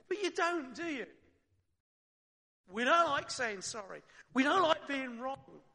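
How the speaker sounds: ordinary delivery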